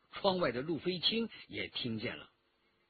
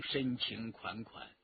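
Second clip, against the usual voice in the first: ordinary delivery, nothing marked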